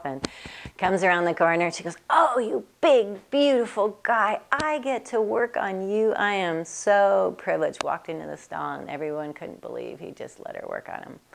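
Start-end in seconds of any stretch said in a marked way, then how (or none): 2.1-7.8 high, slow, cooing praise; slow fond cooing to a feared horse; sweet-talking a horse. Retold or imitated. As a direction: coo up at a big beautiful horse. Woman sweet-talking big horse.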